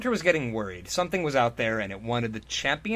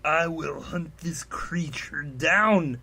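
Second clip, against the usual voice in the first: slow hushed menace; understated growled vow; 3 semitones higher, much slower than usual. Acted. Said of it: "he growled".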